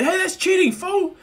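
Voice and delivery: very high, loud cry of foul; acted outraged yelp; indignant protest. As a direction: protest loudly at a cheating friend. Overtaken boy protests.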